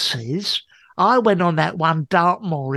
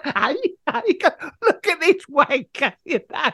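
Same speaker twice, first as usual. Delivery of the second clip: taunting calls, much higher; mock heckling; 9 semitones higher than usual. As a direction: jeer at him across the camp. Calling out mockingly, high pitch.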